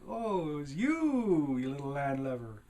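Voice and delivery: hammed-up accusation, stretched and swooping up; accusing. Book character confronting the kid who hit him, mock accusing.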